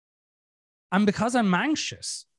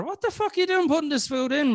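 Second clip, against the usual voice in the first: higher, quicker mock telling-off; mock indignant bacteria; 8 semitones higher, faster than usual. Bacteria to eater putting food in, mock indignant. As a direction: rattle it off as an outraged little germ.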